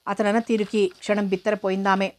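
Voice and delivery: ordinary delivery, nothing marked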